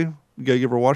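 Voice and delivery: ordinary talk, nothing marked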